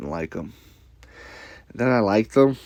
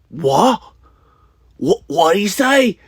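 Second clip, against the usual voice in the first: mocking, high-pitched English accent, loud; Ian Gary impression; 10 semitones higher, 7 dB louder, slower than usual. A fan mocking Ian Gary, high loud English accent. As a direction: ham up a shocked English accent. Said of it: "my Connor's way better than my Ian Gary".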